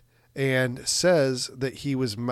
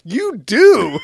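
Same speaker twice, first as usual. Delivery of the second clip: loud, high exclamation with a laugh; emphatic agreement; 10 semitones higher, 13 dB louder than usual. Retold or imitated. Host cheering on the actor's award boast, loud and emphatic. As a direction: burst out in high-pitched, delighted agreement.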